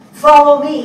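loud, firm order to the men; commanding call. Retold or imitated. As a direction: lead them in, call it out strong. Eulogy quotes Patrick's command.

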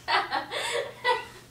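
high, breathy giggling fit; burst of laughter. Real, not acted. Teased by a friend about her speech, laughing. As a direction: crack up laughing.

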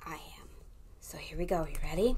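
soft secret whisper. Acted. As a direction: hush it to the kids. Hushed before story.

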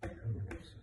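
barely voiced, very soft; pondering under breath. Trying to recall who Brendan is, whispered to himself. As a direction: whisper it to yourself while thinking. Repeats job title, trying to remember.

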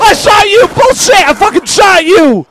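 high, loud furious yelling; indignant shriek at being cheated; outraged screaming. Real, not acted. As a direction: scream a frantic protest. Killed despite shooting the monster.

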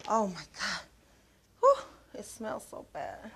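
muttering in mock disgust at the stink; disgusted by smell. Acting out the bathroom smell.

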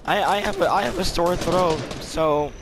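voice trolling. Pretend. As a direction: fake a young kid making excuses. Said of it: "like, um, a voice trolling".

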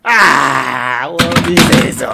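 loud, fast cry of confusion; loud dismay. Acted. Player watching the computer break, loud fast alarm. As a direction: blurt it out in alarm.

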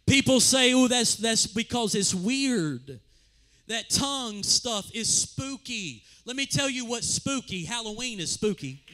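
loud preaching, rising to a shouted climax; full-force preaching. Acted. Preacher to his congregation, preaching loud at full force. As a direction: thunder it from the pulpit like a Pentecostal preacher.

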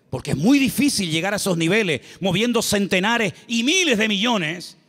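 usual speaking voice

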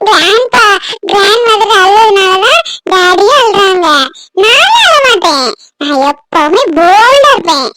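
put-on slow, high child voice; childish singsong boast; bold little grandchild. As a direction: declare it proudly with long drawn-out vowels.

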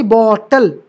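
Bottle with a drawn-out, stressed first syllable. Shown as a demonstration. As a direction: lean long on the बॉ of bottle. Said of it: "यहाँ जो pitch है, high pitch है".

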